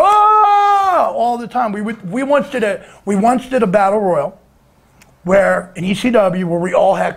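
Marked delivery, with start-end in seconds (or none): 0.0-1.1 long, high, held "aah"; loud high-pitched impression holler; mock Bruiser yell. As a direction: bellow a drawn-out wrestler cry. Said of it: "where I would go".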